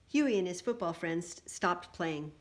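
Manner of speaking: ordinary delivery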